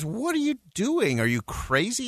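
high-pitched incredulous question; acted disbelief. A narrator's reading. Trapped man to brother who jumped in, acted disbelief. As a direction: start high in dismay, then ease down.

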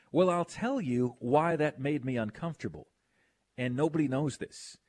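ordinary delivery

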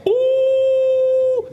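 long, high "ooh"; loud, sustained whoop of amazement; astonished ooh. Real, not acted. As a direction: let out a drawn-out high ooh. Reacts to Moses's bold words.

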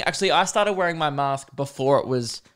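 ordinary delivery

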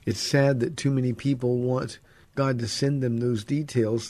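ordinary talk, nothing marked

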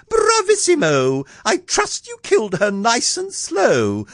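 very high, screechy royal delight; queen crying out. A narrator's reading. Queen praising the huntsman for killing, high gleeful cry. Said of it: "The Queen cried out".

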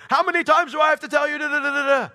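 high, loud, fast scolding rant; exasperated parent. Acted. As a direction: yell it like a parent who has had enough. Parent scolding his kid, acted out.

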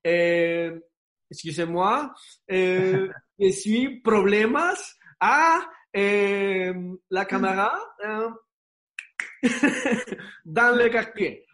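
high, laughing, halting French; struggling, laughing through bad French; broken French. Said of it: "le dije como". (francés chapuceado entre risas)

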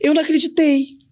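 high burst of disbelief; disbelieving. Real, not acted. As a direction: still can't believe her luck.

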